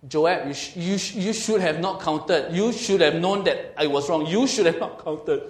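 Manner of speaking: mock blaming. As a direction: pin the blame on Joab, loud. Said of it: "He didn't say".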